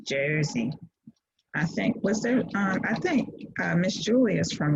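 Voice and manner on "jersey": "Jersey" in a New Jersey accent, as "Shoy-zee". Shown as a demonstration. Teacher naming the state, mock New Jersey accent. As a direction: play up the New Jersey vowel in "Jersey". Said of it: "I had to say it with my Jersey accent".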